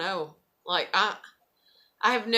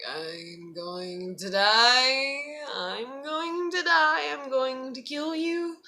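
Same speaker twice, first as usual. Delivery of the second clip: melodramatic despair; 3 semitones higher, much slower than usual. Retold or imitated. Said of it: "And I'm just like".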